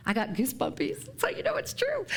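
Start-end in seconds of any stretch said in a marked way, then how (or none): 1.2-2.1 a breathy high whisper; whispered aside. Real, not acted. Minister whispering an aside to the congregation. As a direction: let the words slip out as a secret aside.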